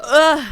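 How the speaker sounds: a big real belch; loud burp. Real, not acted. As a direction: let out a loud gross burp. Burp after tacos.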